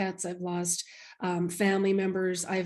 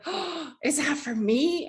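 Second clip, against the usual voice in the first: higher, slow and hushed with wonder; awed surprise; 6 semitones higher, slower than usual. Retold or imitated. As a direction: ask it as if you can't believe the gift. Stunned mother to child.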